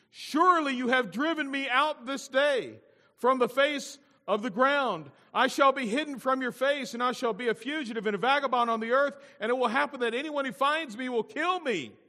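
high, loud outcry; impassioned protest. Acted. Cain protests being driven out, impassioned protest. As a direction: plead the case at full voice. Reads Cain's protest in full voice.

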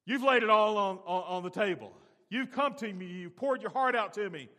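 loud fervent voice of God; preacher voicing God, full force; God speaking. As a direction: proclaim God's words to Jacob. God's voice comforting Jacob.

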